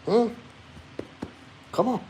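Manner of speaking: soft, high coaxing; coaxing pet talk. Real, not acted. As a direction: gently call the dog closer. Coaxing the dog over.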